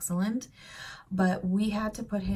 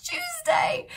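Tuesday breathed British style, very high; whispered British accent; 4 dB louder than usual. Retold or imitated. Mom repeating son's British Tuesday, whispered. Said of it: "correcting my American accent into the correct British one".

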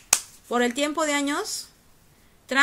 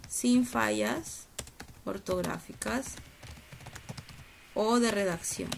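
very slow, sinking lower and softer; slow dictation; 3 semitones lower, 5 dB quieter, slower than usual. Real, not acted. Teacher noting the standard for written work, slow dictation. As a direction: stretch the words as if writing them down. Dictating while writing for class.

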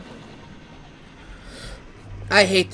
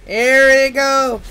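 high, loud, drawn-out cheer; excited shout; 4 semitones higher, 6 dB louder, slower than usual. Real, not acted. Player finally finds the rift, excited shout. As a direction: call it out slowly in triumph.

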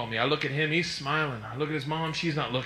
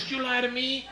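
lightly voiced mom, higher pitched; raised-pitch maternal interrogation, not shouted; questioning mother; 7 semitones higher, 4 dB quieter than usual. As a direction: imitate a mother challenging her son. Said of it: "She goes".

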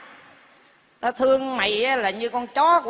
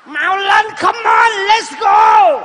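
excited urging; 7 semitones higher, 8 dB louder, much slower than usual. Acted. Grandpa urges singer on.

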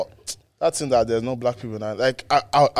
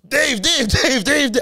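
high urgent shout of the name; 12 semitones higher, 4 dB louder, slower than usual. Retold or imitated. Friend shouting at the dozing driver, urgent high yell. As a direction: yell at the sleeping driver to wake him. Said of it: "So I was shouting".

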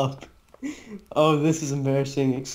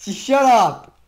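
annoyed shout; 5 semitones higher, 6 dB louder than usual. Real, not acted. Annoyed at teammate's taunts.

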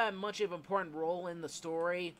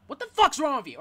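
loud, raised outburst of disbelief; angry outburst; 6 semitones higher, 8 dB louder than usual. Real, not acted. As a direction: snap at him, louder and higher. Angry outburst at a game character.

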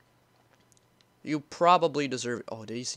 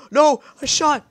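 much higher, louder alarmed cry; panicked; 11 semitones higher, 8 dB louder than usual. Real, not acted. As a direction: realize the blunder. Panic at mistake.

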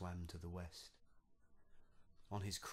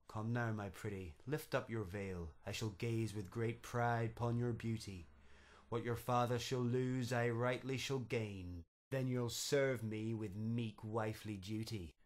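loud, lordly king, gloating over his bride; pompous otter king; 3 semitones higher, 7 dB louder than usual. Acted. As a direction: swagger it like a proud king claiming a prize.

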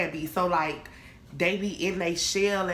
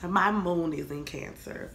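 ordinary talk, nothing marked

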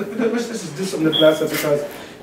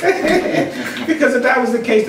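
laughing; 6 semitones higher, much slower than usual. Real, not acted. Still laughing at own joke.

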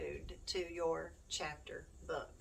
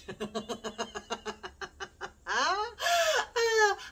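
high, loud burst of laughter; big laugh; 14 semitones higher, 9 dB louder than usual. Real, not acted. Vlogger laughing at her own opening line.

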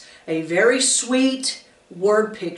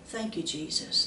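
granny's soft prayer; 6 semitones lower, 12 dB quieter than usual. Retold or imitated. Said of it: "She would say things like".

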